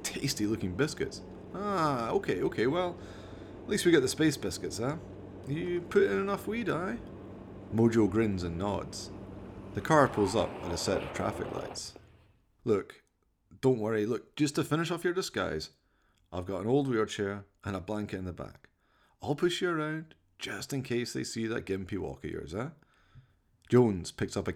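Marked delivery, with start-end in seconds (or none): 1.5-7.0 lightly voiced Scottish mate telling off his chimp; a hint of a Scottish lad; Jones, exasperated driver. 12.6-22.7 lightly voiced Scottish mate telling off his chimp; a hint of a Scottish lad; Jones, exasperated driver.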